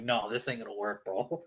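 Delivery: deadpan low mutter. Retold or imitated. Inner reaction to the Wilson presser.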